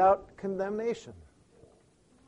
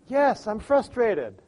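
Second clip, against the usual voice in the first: relieved agreement; 6 semitones higher, 4 dB louder, slower than usual. Retold or imitated. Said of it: "she went".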